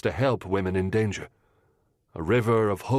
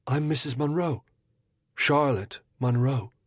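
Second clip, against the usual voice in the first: lightly voiced young lady; 5 semitones higher than usual. Mrs Munro introducing herself to the detective, lightly voiced.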